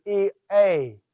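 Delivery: E, a said as two separate vowel sounds; E, a with a clean split between the vowels; E, a with the iota and eta kept apart. Shown as a demonstration. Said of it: "two separate pronunciations".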